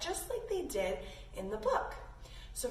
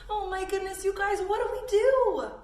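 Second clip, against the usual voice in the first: laughing worry; 8 semitones higher, 6 dB louder than usual. Acted. Character asks kids for help.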